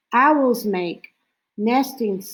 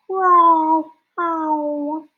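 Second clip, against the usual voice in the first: high, loud growling owl call; snowy owl call; 7 semitones higher, 3 dB louder than usual. Retold or imitated. Showing kids the snowy owl's call, high growl. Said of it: "And the snowy owl goes".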